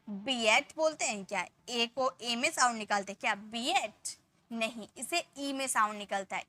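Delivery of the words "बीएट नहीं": ordinary delivery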